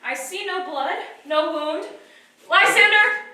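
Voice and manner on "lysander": loud urgent call to wake him. Acted. Helena trying to wake Lysander, loud urgent call. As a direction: rouse him with your voice. Shaking the sleeper awake.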